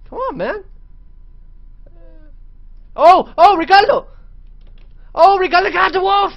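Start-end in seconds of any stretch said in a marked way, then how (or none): 2.9-6.3 thrilled shriek; shrieking jackpot winner. Real, not acted. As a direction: scream with joy, high and loud. Pet scores a kill.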